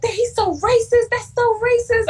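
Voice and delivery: high, slow indignant squeal; mock-outraged gossip voice; outraged friend. Friends outraged on her behalf.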